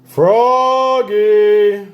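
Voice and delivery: loud, drawn-out call; loud long call from Dad. A narrator's reading. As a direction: call him to hurry up. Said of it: "called Dad".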